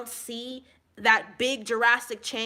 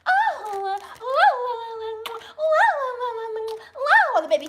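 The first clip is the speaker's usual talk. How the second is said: put-on high, lightly laughing baby coo; cooing over baby; 10 semitones higher, 4 dB louder than usual. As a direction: gush a little over the baby. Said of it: "So what I'll do is go".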